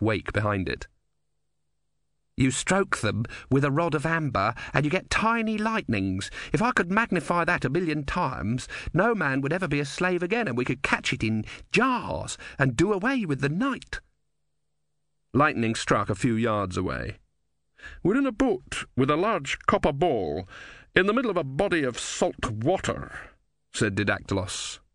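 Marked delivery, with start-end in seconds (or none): 2.4-14.0 high, rapt visionary voice; enthusiastic inventor. A narrator's reading. Narrator voices Ern the dreaming inventor. 18.0-23.1 lightly voiced, higher-pitched reminder; wry philosopher. A narrator's reading. Didactylos warns amid lightning.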